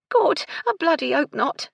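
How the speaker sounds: high, loud grumbling maid; cross servant girl's complaint; disgruntled. A narrator's reading. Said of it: "Gertie muttered".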